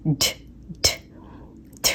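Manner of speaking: the sound "ढ" said alone, short and breathy; "ढ" on its own; isolated sound demo. Shown as a demonstration. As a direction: repeat "ढ" as a bare sound. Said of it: "इस तरीके से sound आता है".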